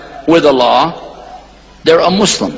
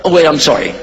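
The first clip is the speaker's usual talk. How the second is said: normal talking voice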